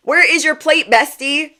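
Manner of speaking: high, loud teasing question to a friend; playful call out. Acted. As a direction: tease your bestie loudly.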